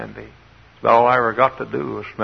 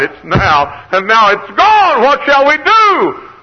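loud, high, desperate pulpit cry; full-force preaching; 11 semitones higher, 11 dB louder than usual. Acted. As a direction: shout the people's plea, building to a wail. Preacher voices Israelites' panic.

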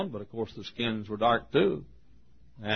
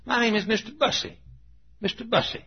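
higher-pitched Southern dialect voice; imitated Southern deacon; 11 semitones higher than usual. Deacon introducing himself to visiting preacher, high Southern dialect. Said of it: "this is the way he talked".

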